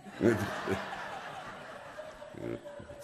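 a short breathy laugh after the punchline; breathy laugh at own joke. Real, not acted.

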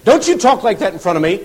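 loud, high indignant reprimand; mock stern rebuke; imitated scolding. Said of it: "he'd come up and he'd say".

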